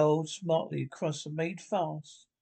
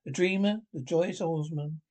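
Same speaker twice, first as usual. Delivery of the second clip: usual speaking voice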